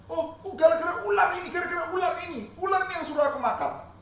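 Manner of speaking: high, loud frightened excuse; blaming whine. Acted. Eve blaming the snake before God, high loud whine. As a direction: shift the blame, pleading.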